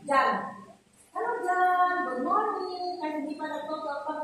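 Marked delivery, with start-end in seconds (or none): 1.1-3.0 higher, louder bright hello; teacher greeting a baby to show eye contact, raised and loud; cheerful greeting to baby. Shown as a demonstration. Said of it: "ano bang halimbawa natin?".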